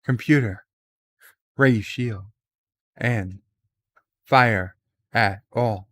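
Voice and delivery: halting, dramatic pauses between phrases, lightly voiced Shatner; a hint of Captain Kirk, word by word with pauses; William Shatner impression. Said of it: "to do his Shatner impression".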